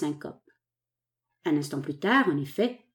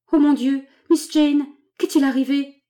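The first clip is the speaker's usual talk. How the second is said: high, loud cry of alarm; 6 semitones higher, 6 dB louder than usual. A narrator's reading. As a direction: cry out in shock at the sight. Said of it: "s'écria la bonne femme".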